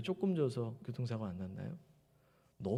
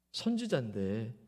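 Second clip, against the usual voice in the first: ordinary delivery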